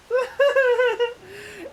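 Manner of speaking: squealing burst of laughter; high shrieking laugh. Real, not acted. Reacts to bad selfie.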